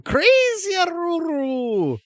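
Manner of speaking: name howled high, loud and drawn out; howling at the moon. Acted. Crazy trader announcing his own name, howling at the moon. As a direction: throw it up like a long wolf howl. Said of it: "as though he's howling at the moon".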